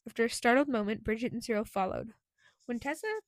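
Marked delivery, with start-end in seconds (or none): none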